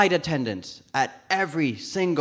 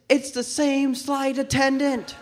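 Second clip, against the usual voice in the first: usual speaking voice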